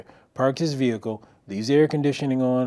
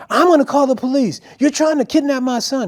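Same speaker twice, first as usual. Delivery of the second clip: high, loud threatening yell; screaming mother; 10 dB louder than usual. Retold or imitated. Mom threatening the stranger with police, screaming. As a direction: yell the accusation at full pitch. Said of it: "she's screaming".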